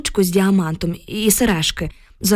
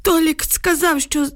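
put-on higher-pitched voice of an old villager; old village man; 8 semitones higher than usual. A narrator's reading. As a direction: tell it as a worried grandpa, pitched up. Old villager recounts what he saw.

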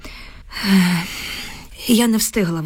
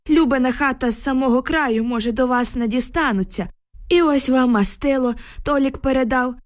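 put-on higher-pitched, anxious warning to the girls; pleading villager; 4 semitones higher than usual. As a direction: beg them to go home, pitch raised. Villager begs the girls to turn back. (благальний, вищий голос селянського застереження)

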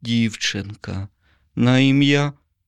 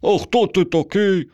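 high, far simpleton voice; high-pitched peasant fool's question; simpleton asking; 12 semitones higher than usual. A narrator's reading. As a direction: dim lad asking who he is. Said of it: "спитав Ваня".

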